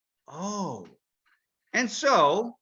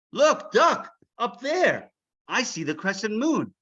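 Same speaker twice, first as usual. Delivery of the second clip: put-on bright eager boy's call, higher; cheerful; 5 semitones higher, 3 dB louder than usual. Ilyas spotting the moon for Duck, cheerful. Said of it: "Ilyas said with cheer".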